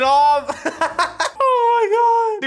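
high, loud, slow laugh-cry; helpless laughing. Real, not acted. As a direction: laugh until it almost turns to crying. Laughing at spinning man.

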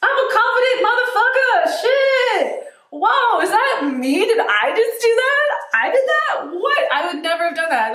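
high, loud, incredulous outburst; hammed-up, high-pitched astonishment at your own boldness; amazed self-celebration. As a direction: exclaim it like you've stunned yourself. Acts out amazed future self.

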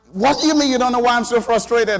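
high, loud comeback in an acted-out quarrel; imitating an indignant yelled retort; retold frustrated shouting. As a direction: shout back in mock exasperation. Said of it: "we had a shouting match".

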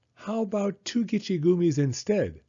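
put-on high-pitched child haggling; bargaining kid. A narrator's reading. Son haggling with mom over toys, high bargaining voice. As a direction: make the offer as a small boy.

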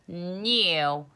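new said the British way, nyoo; British English pronunciation. Shown as a demonstration. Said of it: "이게 영국식".

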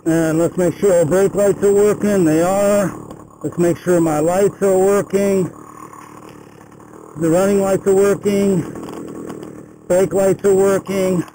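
muffled Bluetooth helmet mic; speaking inside a closed helmet. Real, not acted. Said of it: "I'm gonna be wearing a enclosed helmet".

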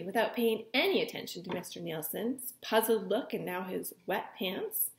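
usual speaking voice

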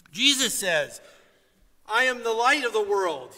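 loud, ringing pulpit preaching; preaching at full force. Acted. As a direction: preach it out to the whole congregation. Preaches from scripture.

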